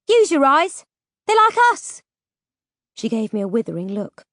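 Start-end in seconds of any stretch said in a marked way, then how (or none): 0.1-2.0 high, loud protest building in pitch; exasperated insistence. Acted. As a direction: raise your voice and insist. Petra arguing back at Lackland.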